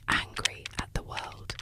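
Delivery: usual speaking voice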